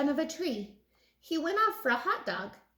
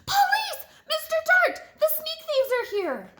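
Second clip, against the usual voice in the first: very high, loud yell for help; loudest shout; 6 dB louder than usual. Acted. Stanley yelling from the frame to call police. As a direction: shout for the police with all his might. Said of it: "In his loudest voice, he shouted".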